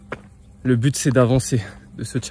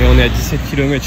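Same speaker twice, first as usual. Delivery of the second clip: loud and fast; fired up; 7 dB louder, faster than usual. Real, not acted. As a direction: push through the run, loud. Running, pushing on.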